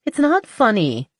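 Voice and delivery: half-laughing protest at Ronan. Acted. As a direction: push back, mock offended. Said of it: "she protested".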